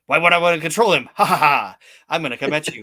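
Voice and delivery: fast, loud, hammed-up villain; fast villain speech. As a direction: rattle it off like a cartoon baddie. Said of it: "he's speaking really fast".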